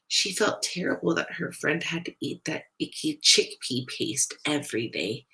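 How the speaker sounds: ordinary delivery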